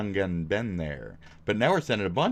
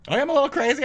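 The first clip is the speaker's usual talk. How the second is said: squeaky high voice, much higher and louder; mock crazy voice; 5 dB louder, much faster than usual. Streamer jokingly plays the madman to chat, squeaky. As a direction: act crazy in a squeaky pitch.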